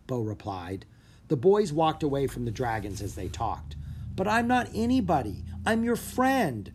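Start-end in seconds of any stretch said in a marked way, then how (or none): 4.1-6.7 hurt, higher retort to his friend; shot back. Acted. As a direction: snap it back, stung. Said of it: "Drake shot back".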